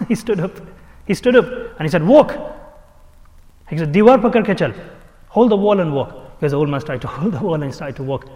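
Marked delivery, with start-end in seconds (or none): 2.0-2.4 loud, high single-word order; commanding. Retold or imitated. Healer to old man just risen, commanding. 3.9-6.1 loud, higher-pitched instruction; commanding. Retold or imitated. Healer telling old man to use the wall, commanding.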